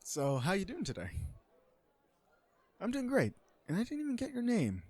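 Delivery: exaggeratedly high-pitched polite chitchat; mock normal small talk. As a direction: overdo the friendly first-date chat. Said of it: "Just normal talk then?".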